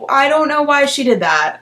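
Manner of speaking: plain reading voice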